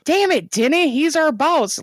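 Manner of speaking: high, exasperated mock protest; half-hearted hero outburst; mock exasperated agent. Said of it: "that's literally how that episode should have ended".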